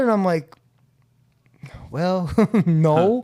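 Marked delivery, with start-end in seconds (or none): 1.9-3.2 laughing out the no; sheepish laugh. Real, not acted. Admitting to his sponsor it wasn't the first time, laughing.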